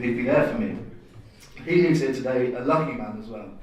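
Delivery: normal talking voice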